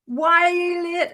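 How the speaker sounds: loud, high, drawn-out angry demand; guldering. Retold or imitated. Ward sister scolding late nurse, loudly guldering. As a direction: imitate a sister bawling out a latecomer. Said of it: "It was a gulder down the ward".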